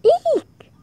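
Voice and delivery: high, startled storybook shriek. A narrator's reading. Teacher spots the escaped bug, shrieking. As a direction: squeal it out loud and very high. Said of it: "shrieked teacher".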